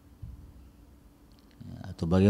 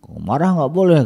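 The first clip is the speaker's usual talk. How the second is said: puzzled objection; 7 semitones higher, 6 dB louder than usual. Retold or imitated. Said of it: "orang berpikir".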